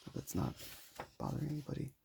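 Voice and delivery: ordinary delivery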